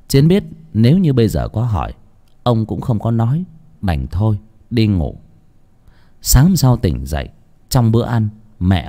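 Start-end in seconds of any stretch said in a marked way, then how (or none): none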